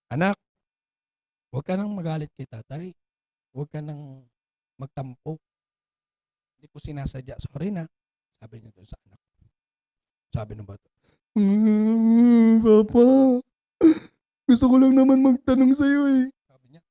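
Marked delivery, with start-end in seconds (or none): 0.1-7.8 soft, low, tender father voice; gentle apology. Acted. Guilty father apologizing to his crying son, soft and tender. 11.4-16.2 put-on whimpering child voice; crying child. Hurt five-year-old pleading with his father, tearful.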